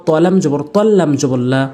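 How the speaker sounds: ordinary delivery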